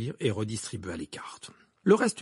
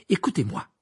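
higher-pitched firm command; 5 semitones higher, 3 dB louder than usual. Acted. Harry to the twins, cutting in, firm. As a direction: demand their attention firmly. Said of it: "reprit Harry d'un ton ferme".

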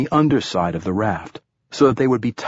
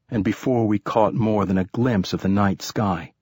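usual speaking voice

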